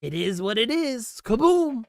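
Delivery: imitating the silo host, punchy finish; Airbnb host's catchphrase. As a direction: toss it off then blow it up. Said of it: "and he goes".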